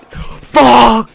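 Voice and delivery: sudden high yell of fright; startled shout. Real, not acted. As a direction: scream it as the scare hits. Jump scare reaction.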